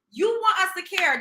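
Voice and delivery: normal talking voice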